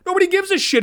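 high, loud yell; angry yell. Real, not acted. Yelling at a nobody sports writer, high and loud. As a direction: blow up on the last words.

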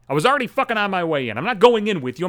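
normal talking voice

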